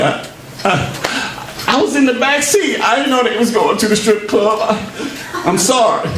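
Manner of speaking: high, whiny fake sob apology; mock crying apology. A man caught at the strip club pleads in mock tears. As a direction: whimper out a pretend apology.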